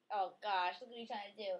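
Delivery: ordinary delivery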